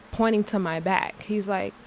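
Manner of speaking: ordinary talk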